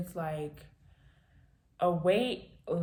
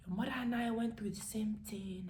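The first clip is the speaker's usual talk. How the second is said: whispered confidence; 3 semitones higher, 6 dB quieter than usual. Retold or imitated. Father's confiding words.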